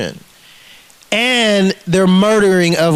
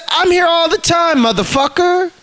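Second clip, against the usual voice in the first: defiant retort; 8 semitones higher than usual. Retold or imitated. Retelling his defiant answer.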